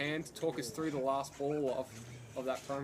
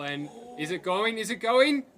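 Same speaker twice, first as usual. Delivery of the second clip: rising call, louder each time; building excitement; 7 semitones higher, 10 dB louder than usual. Real, not acted. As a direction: build the call as the ball flies. Last ball, live call.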